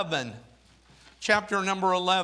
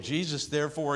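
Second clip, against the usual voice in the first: ordinary talk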